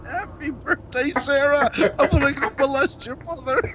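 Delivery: high, cracking up mid-line; giggling through each word; laughing. Real, not acted. Laughing through cameo take.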